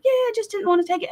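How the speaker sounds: put-on high, quick little-girl voice; daughter's casual reply. Daughter shrugs off quitting her medicine, high and quick. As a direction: toss it off high and fast like a kid. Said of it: "she was like".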